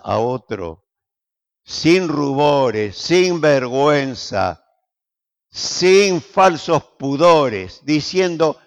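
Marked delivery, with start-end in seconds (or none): 1.6-7.8 each phrase spaced out, slow and deliberate; slow emphasis. Real, not acted. Preacher urging the church to witness, slow and deliberate. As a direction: let every "sin" land slowly. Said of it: "preacher's measured list".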